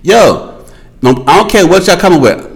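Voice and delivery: ordinary talk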